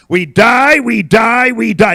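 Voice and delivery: loud, high preacher's chant; insistent pulpit shout; emphatic repetition. Real, not acted. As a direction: pound the refrain out at full voice. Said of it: "I'm gonna say it again".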